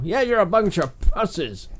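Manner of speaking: slow, drawled American radio host; American shock jock. Retold or imitated. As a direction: ham up a brash American DJ. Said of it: "sound like an American shock jock".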